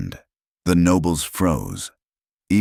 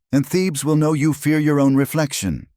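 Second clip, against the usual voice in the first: high, forceful threat; snapped threat; 11 semitones higher, 3 dB louder than usual. A narrator's reading. Zahira defies her sister before Thebes' court, snapping. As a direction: throw the threat down, pitch held high. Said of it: "Zahira snapped".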